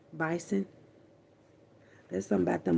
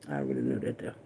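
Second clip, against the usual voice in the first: whispered aside; 9 dB quieter, much faster than usual. Real, not acted. Muttered aside.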